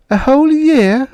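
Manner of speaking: put-on high, loud child's cry of disbelief; a boy hearing bad news; stunned high-pitched question; shocked child. A narrator's reading.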